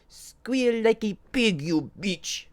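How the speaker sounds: very high, loud and slow impatient imp; impatient demand. A narrator's reading. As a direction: lose patience, spit the order out slowly. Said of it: "with even less patience now".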